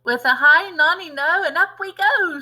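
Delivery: high, loud sing-song crow; shouted. Acted. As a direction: chant it out wildly. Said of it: "she shouted".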